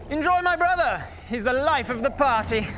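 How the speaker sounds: high, manic showman cry; taunting villain flinging a boast; gleeful villain. Acted.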